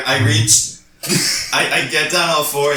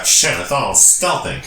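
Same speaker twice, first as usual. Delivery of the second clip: ordinary delivery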